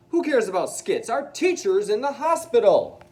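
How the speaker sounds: high, loud angry retort; angry outburst. Acted. Harry scolding Sydney over the teacher, angry shout. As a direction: snap back hard in defence of the teacher.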